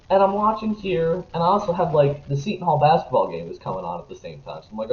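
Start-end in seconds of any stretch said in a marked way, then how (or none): none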